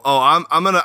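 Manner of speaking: ordinary talk, nothing marked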